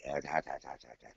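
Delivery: low, dismissive mock of Mike brushing him off; imitating Mike. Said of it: "And he's like".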